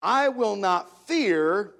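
loud, slow and deliberate; bold declaration. Shown as a demonstration. Preacher declaring the verse against worry and fear, boldly. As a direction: declare it firmly, leaning on each word.

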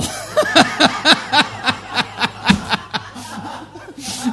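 helpless high laughter bursting out; loud breathy laugh. Real, not acted. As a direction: crack up at your own joke. Breaks up at own joke.

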